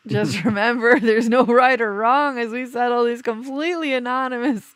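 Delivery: loud, high, hammed-up announcer voice; segment announcer. Host introducing the couples gripes segment, showy announcer.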